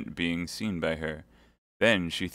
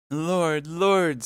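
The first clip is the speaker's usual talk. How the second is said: high, meek plea; angelic meekness; 10 semitones higher, 6 dB louder than usual. Acted. Milady feigning meek prayer before the Baron. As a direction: pray with saintly humility. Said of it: "with an angelic meekness of gesture and tone".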